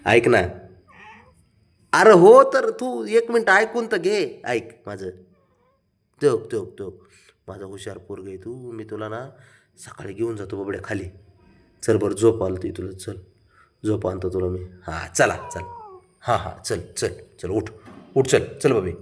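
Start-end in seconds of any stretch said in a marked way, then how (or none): 1.9-4.4 high, loud, drawn-out plea; insistent high-pitched appeal; exasperated pleading. Real, not acted. Pleading with sulking pet to listen. 4.4-17.7 low, soft soothing murmur; gentle, hushed reassurance; tender coaxing. Real, not acted. Soothing sulking pet toward sleep.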